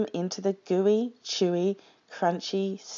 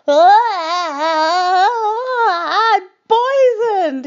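very high, loud, drawn-out wail; howling; 12 semitones higher, 9 dB louder, much slower than usual. A narrator's reading. Dingo after slurping the stew, anguished howl. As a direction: howl it out in storybook agony. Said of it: "he howled".

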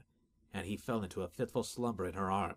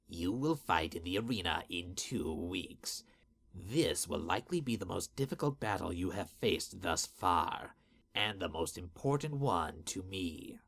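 menacing master voice; 2 semitones lower than usual. Acted. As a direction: speak down to a servant with menace. Master creature addresses servant.

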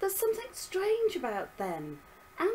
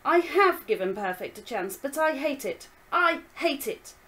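put-on angry girl; 3 semitones higher, 7 dB louder than usual. Daughter argues back.

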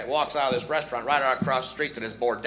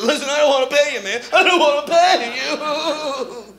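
put-on much higher, whining child; whiny kid voice; 11 semitones higher, 7 dB louder than usual. Man refusing to pay bookie, high kid whine. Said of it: "use that voice when you got older".

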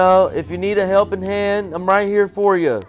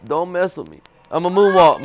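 ordinary delivery

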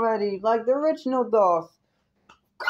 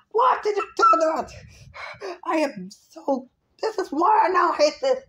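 yelling and screaming; 6 semitones higher than usual. Real, not acted. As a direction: scream at McDonald's like you were truly betrayed. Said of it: "Sorry for this yelling and screaming".

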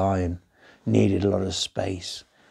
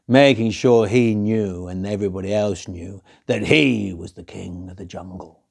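ordinary delivery, nothing marked

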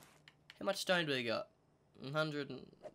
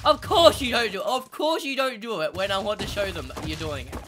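loud exasperated complaint, starting high and settling; 4 semitones higher, 9 dB louder than usual. Real, not acted. Player to dinosaur who won't perform, exasperated. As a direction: scold in loud frustration.

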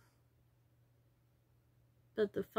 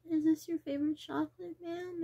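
higher, cheery mock cashier question; imagined cashier; 6 semitones higher than usual. Imagined cashier asking a customer about chocolate, lightly voiced. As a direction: play a chirpy shop clerk. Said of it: "And I just go".

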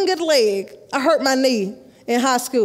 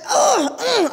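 mock agonized groan, pitched up; groans of pain; 9 semitones higher than usual. Retelling her injury on the track, pained groans. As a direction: groan as if the knee just tore.

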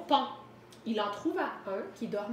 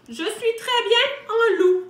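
high, loud storybook finale; triumphant declaration; 10 semitones higher, 11 dB louder than usual. A narrator's reading. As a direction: proclaim it as the wolf's happy conclusion. Wolf's final happy declaration. (déclaration triomphante, forte et aiguë)

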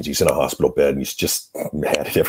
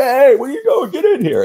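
put-on much higher, louder delighted old man; happy welcome; 6 dB louder than usual. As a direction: grump suddenly lit up with joy. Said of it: "his mood completely changed".

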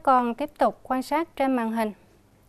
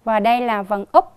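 usual speaking voice

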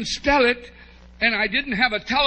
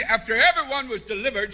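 ordinary talk, nothing marked

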